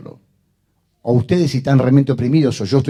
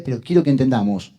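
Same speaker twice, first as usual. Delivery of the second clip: normal talking voice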